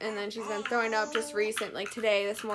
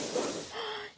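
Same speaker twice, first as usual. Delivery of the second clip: delighted gasp; 12 semitones higher, 6 dB quieter than usual. Real, not acted. Mom sees baby reach star.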